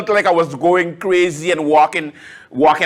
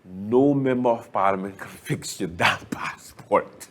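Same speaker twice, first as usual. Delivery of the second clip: whispered punchline; 10 semitones lower, 8 dB quieter than usual. Acted. Punchline aside, last point.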